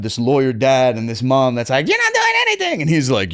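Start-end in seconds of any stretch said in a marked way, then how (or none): 1.9-2.8 mocking high parent voice. Lawyer dad and mom disapproving.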